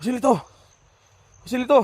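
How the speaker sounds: high urgent shout across the field; calling out. Real, not acted. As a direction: yell the name to find him. Calling out to a hit teammate.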